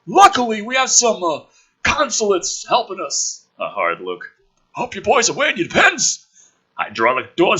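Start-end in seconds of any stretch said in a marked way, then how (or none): 0.0-6.3 high, loud brash military man; gruff officer voice. Acted. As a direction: play the cocky colonel loud and high. Woodhurst voiced in script.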